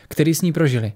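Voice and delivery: usual speaking voice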